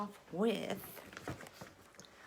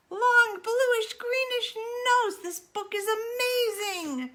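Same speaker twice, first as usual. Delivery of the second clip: high, loud thrilled calling; excited calling; 14 semitones higher, 11 dB louder than usual. Acted. Reader thrilled as the monster comes back piece by piece. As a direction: build the excitement as each part returns.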